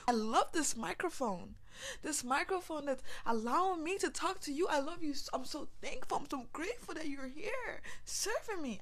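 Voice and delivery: loud, building to high loving cries; gushing gratitude. Pretend.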